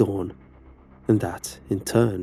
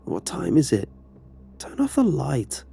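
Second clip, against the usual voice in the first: slow, higher-pitched sleepy complaint; groggy with sleep; 6 semitones higher, 4 dB louder than usual. A narrator's reading. Mom woken by the hall light, groggy. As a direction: drag the words out, just woken. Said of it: "her voice groggy with sleep".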